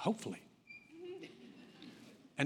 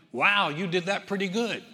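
impressed businessman; 4 semitones higher, 8 dB louder than usual. Retold or imitated. Businessman comments on her jump.

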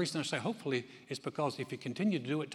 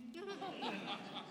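a short real laugh, breathy and high; amused high laugh; 11 dB quieter than usual. Real, not acted. Pastor telling a story to the church, with a real amused laugh. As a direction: laugh at the punchline.

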